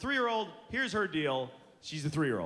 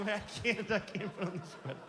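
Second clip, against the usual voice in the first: mock tearful helplessness; hammed-up teary defeat; smiling through tears; 3 dB quieter than usual. Dad failing to button toddler's sweater, mock tearful. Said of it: "smiling with tears".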